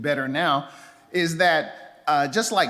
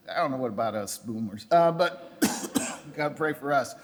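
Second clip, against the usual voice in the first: ordinary talk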